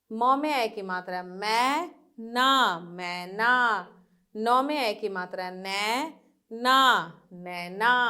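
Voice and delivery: ordinary talk, nothing marked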